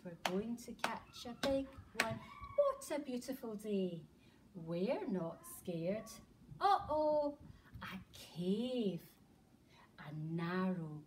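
plain reading voice

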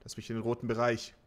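ordinary talk, nothing marked

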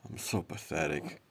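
soft muttered to himself; self-pitying mutter. Acted. Man scolding himself, soft self-pity. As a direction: sink into shame.

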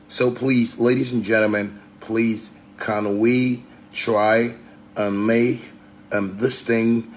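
slow, measured, over-polite plea; mock polite appeal. Addressing ladies and gentlemen as a polite speaker, slow mock courtesy. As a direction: plead politely, drawing out each phrase. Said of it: "If you talk to me in that manner".

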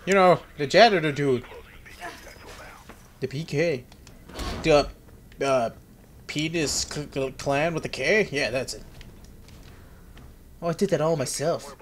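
ordinary talk, nothing marked

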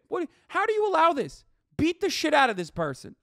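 exasperated tirade demanding action; furious rant. Real, not acted. Streamer urges Nancy Pelosi to whip the moderates, furious.

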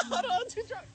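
squeezed out through high wheezing laughter; laughing while talking. Real, not acted. Drunk streamer begging pause through laughter, wheezing. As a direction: barely get the words out between laughs.

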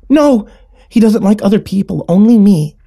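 put-on high, loud child's refusal; protesting little sister. A narrator's reading. Little sister shields her friend in the closet, protesting.

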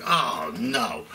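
low, sinking dismay; deflated, low-voiced mock dread. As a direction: groan it inwardly, low and resigned. Dreading big seatmate's arrival.

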